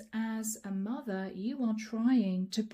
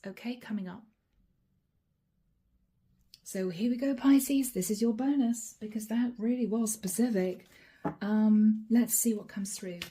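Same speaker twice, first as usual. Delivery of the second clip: ordinary talk, nothing marked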